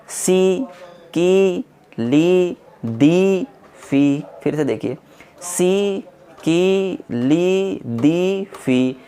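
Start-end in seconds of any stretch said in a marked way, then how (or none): 0.1-4.2 सी, की, ली with long, high held ee; slightly stretched. Shown as a demonstration. Teacher showing students the long ee, each syllable stretched. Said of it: "थोड़ा सा उसे खींचेंगे". 5.4-9.0 सी, की, ली with long, high held ee; slightly stretched. Shown as a demonstration. Teacher showing students the long ee, each syllable stretched. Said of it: "थोड़ा सा उसे खींचेंगे".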